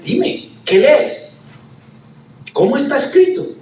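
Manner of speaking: challenging question. Retold or imitated. Jesús challenges the lawyer.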